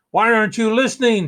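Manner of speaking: high, loud, fast angry shout; king shouting. Acted. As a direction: shout in frustration at the boy. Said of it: "shouted the king".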